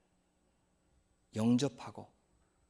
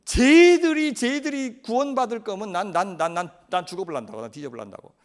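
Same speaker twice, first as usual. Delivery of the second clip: explode, then mutter the last line; defiant outburst fading; 3 semitones higher, 7 dB louder than usual. Acted. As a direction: tantrum running out of steam. Jonah defies God.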